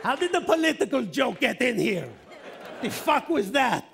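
high, loud, hammed-up indignation; mock outrage. Comic protests his own political joke, mock-outraged shout. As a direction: holler it as if scandalized by your own gag.

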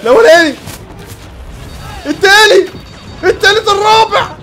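high, loud excited count; exhilarated yelled tally; triumphant shouting. Real, not acted. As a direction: shout each one out in triumph. Counting off kills in game.

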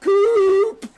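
high, loud mocking yell of the name; reliving the yell, loud and high; retold yelling. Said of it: "I was in here yelling".

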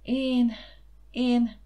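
too happy to get past the first word; faltering with joy; happy stammer. A narrator's reading. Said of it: "rebegte boldog mosollyal Cilike".

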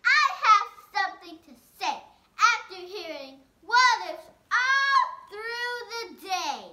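firm speaking up. Acted. Jonathan finally speaks up.

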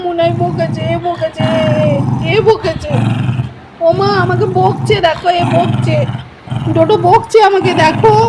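high, drawn-out pretend whine; mock tearful complaint. Owner complaining to mother about being scolded, mock whining.

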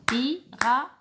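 Pirate split into three beats; clapped syllables. Shown as a demonstration. Teacher clapping out Pirate for counting. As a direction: say pi-ra-te with a clap on each beat. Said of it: "Encore une fois".

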